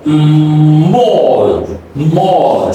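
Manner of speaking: "mall" long, rounded aw into dark L; dark L practice. Shown as a demonstration. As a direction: hum into and stretch "mall". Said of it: "let's practice with these dark L".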